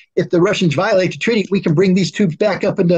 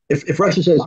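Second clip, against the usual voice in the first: ordinary talk, nothing marked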